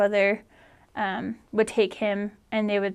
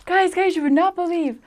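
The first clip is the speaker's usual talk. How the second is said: excited little brother; 8 semitones higher than usual. Retold or imitated. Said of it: "he was like".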